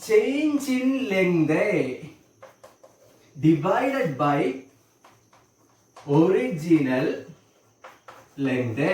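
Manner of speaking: very slow, word by word with long pauses; slow dictation. Real, not acted. Teacher spelling out the strain formula for students, slow dictation. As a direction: dictate it slowly, stretching each word.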